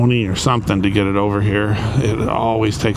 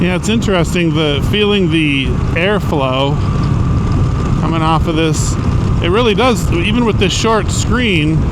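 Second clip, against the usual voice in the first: louder and higher, talking over road wind; raised voice over wind; 6 semitones higher, 4 dB louder than usual. Real, not acted. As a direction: speak up inside a helmet on the move. Talking in helmet while riding.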